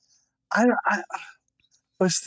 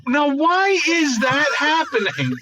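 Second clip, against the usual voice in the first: higher, slower, hammed-up puzzled voice; a put-on baffled voice with a laugh; mock bewilderment; 9 semitones higher than usual. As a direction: play it as fake outrage, drawn out. Mock reaction to friend's joke.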